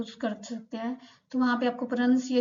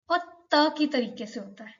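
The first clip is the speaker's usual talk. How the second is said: ordinary delivery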